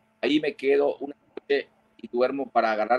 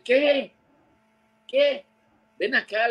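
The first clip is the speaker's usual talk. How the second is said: pitched way up, imitating a distant shout; high mock voice; 13 semitones higher, slower than usual. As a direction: squeak it out like a faraway answer. Retold shouting across water. (voz aguda imitando un grito lejano)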